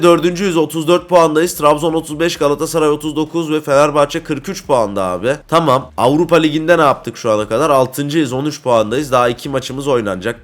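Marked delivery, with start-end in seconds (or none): none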